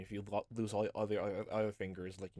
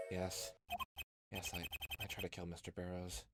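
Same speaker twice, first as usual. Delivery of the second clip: quiet admission; 5 semitones lower, 9 dB quieter than usual. Acted.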